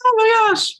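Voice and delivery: overwhelmed exclaim. Real, not acted. Overwhelmed after emotional moment.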